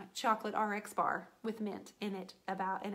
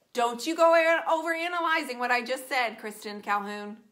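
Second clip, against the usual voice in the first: mock scolding; 8 dB louder than usual. Teasing friend Kristen for overanalyzing, loud mock scolding. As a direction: scold her friend in jest.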